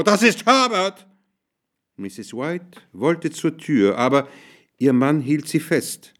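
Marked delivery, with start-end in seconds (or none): 0.0-1.1 hoch aufkreischen, außer sich; Kreischen. A narrator's reading. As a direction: kreischende Frau in heller Aufregung. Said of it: "kreischte die Frau". (schrill kreischen)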